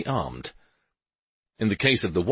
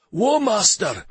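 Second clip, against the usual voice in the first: urgent shout, high and loud; 13 semitones higher, 5 dB louder, slower than usual. Acted. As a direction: call out in alarm. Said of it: "the warrior shouted".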